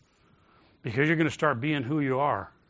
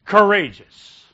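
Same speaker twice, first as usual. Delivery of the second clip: COURAGEOUS punched loud and higher; forceful emphasis; 5 semitones higher, 11 dB louder, slower than usual. Real, not acted. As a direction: bark the word as a command. Preacher punches repeated command.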